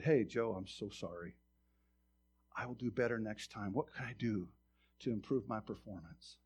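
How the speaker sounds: soft, low submissive apology; low-voiced, deferential; meek apology. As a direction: apologize humbly and quietly to the boss. Apologizing submissively to angry supervisor.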